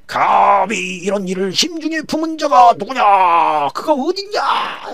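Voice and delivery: high, slow, loud roar of rage; king demanding who plotted against his queen; furious king's shout. Acted. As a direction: bellow the question in outrage. King reading, furious question.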